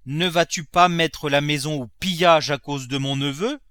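scolding miser Grandet. Acted. Miser accusing his servant over the nephew, loud scolding.

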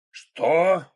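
high, loud roar of disbelief; terrible roar. A narrator's reading. Krot hearing the radio news, terrible outraged roar. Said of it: "страшным голосом заревел Крот".

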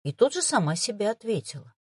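plain reading voice

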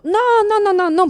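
high, loud protesting no; mock protest; emphatic no. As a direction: cut in fast with a loud denial. Mock denial to audience.

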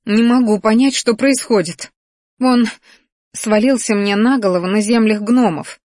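understated roar of bewilderment; upset roar. A narrator's reading. Di protesting to her friends that she understands nothing, upset outburst. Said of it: "взревела Ди".

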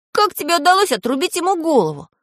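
high-pitched amazed question; marvelling boyish voice; astonished. A narrator's reading. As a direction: ask in wonder, voice way up. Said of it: "удивился Пашка".

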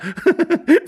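very high short laugh; lady's giggle. Acted. Lady laughing with her husband, giggly. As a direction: giggle helplessly.

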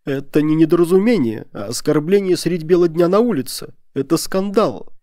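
high, loud, outraged protest; tearful shriek. A narrator's reading. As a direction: cry out as an insulted lady near tears. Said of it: "со слезами в голосе взвизгнула высокая дама".

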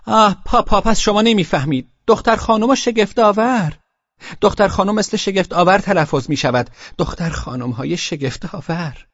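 put-on high, insistent child's voice; exasperated little girl. A narrator's reading. Girls explain pun to papa.

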